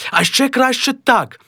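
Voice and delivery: high, eager suggestion; a high, keen wife. Acted. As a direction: offer a better idea. Wife's suggestion.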